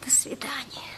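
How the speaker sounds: a breathless, barely voiced farewell; whispered goodbye. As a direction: say goodbye to yourself, deflated. Mother's lonely farewell after they leave.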